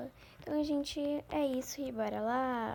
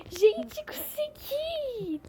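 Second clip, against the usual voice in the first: Gente squealed up, consegui stretched out very high and slow; overjoyed shout; 13 semitones higher, 6 dB louder, much slower than usual. Real, not acted. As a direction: shriek of triumph after finally passing. Finally beats the level.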